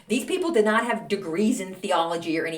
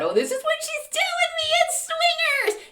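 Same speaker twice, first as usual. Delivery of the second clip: thoughts squealed way up high; high excited inner voice. Retold or imitated. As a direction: gasp it out like a scandal. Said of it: "and I thought".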